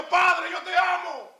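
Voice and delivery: very high cry of love; high shouted declaration. Shown as a demonstration. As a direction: lift it high to the Father. Repeated cry to God.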